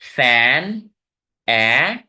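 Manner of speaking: फैन loud and drawn out, then its vowel ए alone; rising, held फैन, pause, ए; isolated syllable. Shown as a demonstration. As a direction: stretch the "ae" in फैन and repeat it as ए. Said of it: "अब मैं बोल रहा हूं".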